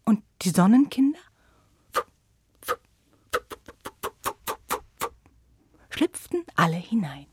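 hushed storyteller whisper. A narrator's reading. Narrator whispers to listening children, hushed. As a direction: breathe it like a secret. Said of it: "whispered narration".

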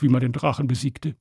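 ordinary delivery

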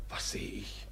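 breathless, slow hushed discovery; young man reliving the night, barely voiced; hushed whisper. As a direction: whisper it as if still in the dark. Recalling the night scene.

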